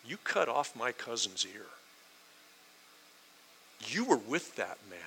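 understated, simmering accusation; ticked off. Acted. Servant girl confronting Peter over her cousin's ear, quietly hostile. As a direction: accuse him with controlled hostility. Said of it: "she's kinda ticked".

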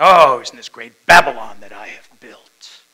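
booming, grandiose boast; boastful Nebuchadnezzar. Acted. Proud king boasting of the city he built, booming. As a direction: proclaim it very loud, full of pride. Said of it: "the heart of Nebuchadnezzar going".

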